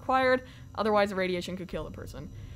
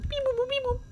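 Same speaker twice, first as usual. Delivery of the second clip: robot beeping; 14 semitones higher, faster than usual. Pretend. Imitating a beeping device.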